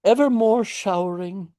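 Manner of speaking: put-on high cooing baby talk; doting father's baby talk; talking to a toddler. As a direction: coo it like to a small child. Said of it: "as you would to a two-year-old".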